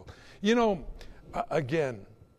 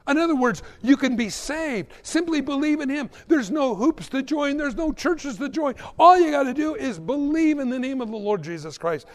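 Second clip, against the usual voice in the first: high-pitched, urgent gospel proclamation; impassioned preaching; 9 semitones higher than usual. Real, not acted. Preacher proclaiming simple salvation to congregation, impassioned. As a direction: press it home on a sustained high pitch.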